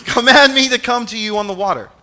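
high, loud comic plea; Peter, mock fearful. Preacher acting out Peter addressing Jesus from hiding, mock fearful. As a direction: call it out in hammed-up fright. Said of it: "as he's cowering underneath, like, the sheets".

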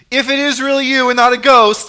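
high, loud comic pleading; cowering, mock fearful. Preacher acting out Peter cowering under the sheets, mock fearful. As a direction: call out like someone hiding in fright. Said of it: "as he's cowering underneath, like, the sheets".

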